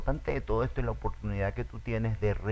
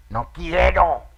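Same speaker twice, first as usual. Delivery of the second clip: put-on higher, louder stubborn child; defiant child's shout; 7 semitones higher, 9 dB louder, much slower than usual. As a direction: yell it back like a spoiled kid. (niño caprichoso gritando)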